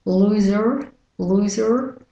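American "loser" with a sounded R; American-accent word demo. Shown as a demonstration. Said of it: "And with the American accent".

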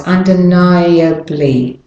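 "Undeniably" slowly, I then a distinct "uh"; two separate vowels. Shown as a demonstration. Teacher demonstrates both vowels for learners, slow careful "Undeniably". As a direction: say "Undeniably" slowly as un-de-NIGH-uh-blee. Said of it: "the I and the A as two separate".

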